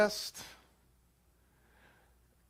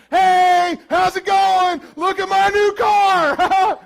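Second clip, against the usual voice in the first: high, loud, slow show-off yell; hammed-up braggart calling from above; gloating rooftop shout; 11 semitones higher, 10 dB louder, much slower than usual. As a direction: crow down at someone in the valley.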